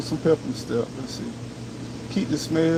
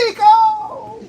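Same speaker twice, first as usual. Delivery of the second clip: high, loud yell across the yard; calling out loud; 6 dB louder than usual. Retold or imitated. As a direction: shout a name from far away. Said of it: "she was calling me".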